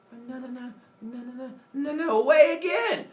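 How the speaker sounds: high singsong, swelling to loud; playful mock defiance; taunting refusal. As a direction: tease with a string of noes, then belt it. Prometheus refuses again, tauntingly.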